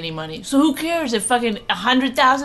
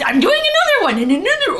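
much higher, louder cartoon voice; silly put-on voice; 14 semitones higher, 7 dB louder than usual. Shown as a demonstration. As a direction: squeal it like a cartoon. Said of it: "I'm doing voice".

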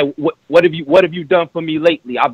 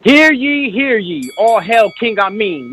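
town crier; 7 semitones higher, slower than usual. Acted. Opening proclamation before rap verse.

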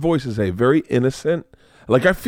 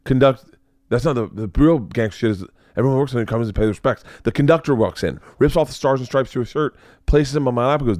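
ordinary talk

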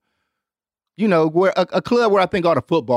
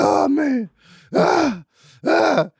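mock moaning; 11 semitones higher, much slower than usual. High on Molly, lying in bed.

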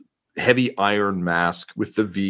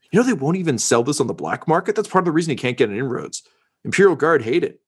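lightly voiced eager reporter, higher than the narrator; chatty reporter; 6 semitones higher, faster than usual. Reporter confiding gossip about a failing dealer, lightly acted and chatty. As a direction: gossip like a reporter, pitch lifted a little.

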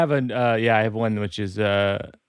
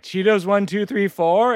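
higher, rising tentative guess; hopeful guess; 7 semitones higher than usual. Acted. Owner trying another password on the computer, tentatively hopeful. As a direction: offer it up like a question.

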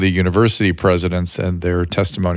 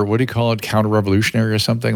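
usual speaking voice

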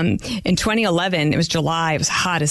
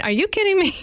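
much higher, quick imitation of her brother's disbelief; mock disbelief; 13 semitones higher than usual. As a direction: toss it up high like a teasing sibling.

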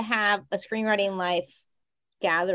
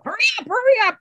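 high urgent demand; pushy producer voice; 5 dB louder, faster than usual. Pretend. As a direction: rush them like a boss. Mock producer urging.

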